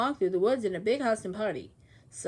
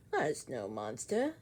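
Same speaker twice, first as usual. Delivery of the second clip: squirrel; 8 semitones lower, 6 dB quieter, slower than usual. Acted. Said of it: "yelled the squirrel".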